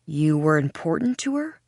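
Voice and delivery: said like a question, rising at the end. A narrator's reading. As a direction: let "her" climb into a tentative question. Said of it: "I say it like a question".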